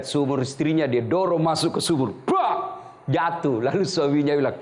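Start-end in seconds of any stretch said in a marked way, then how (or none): 2.3-2.8 high, sharp crash noise; mock crash sound. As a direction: bang it out like a body hitting. Sound of wife pushed in well. (bunyi brak tinggi)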